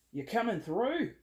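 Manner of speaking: put-on slow, high, playful Aussie accent; mock Australian accent. Reader to Australian Leos watching, mock Australian accent. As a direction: call it out in an amateur Australian accent. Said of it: "a really good Australian accent".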